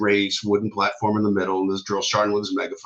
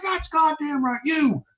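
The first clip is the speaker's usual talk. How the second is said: very high, drawn-out sergeant commands; imitated drill sergeant yell; screaming drill sergeant; slower than usual. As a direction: dismiss one, then order the formation. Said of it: "He's screaming at everybody".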